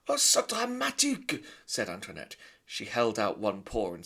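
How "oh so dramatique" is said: put-on very high, loud French-accented mother; haughty French lady; French mother mouse. Antoinette answering her husband, high French haughty.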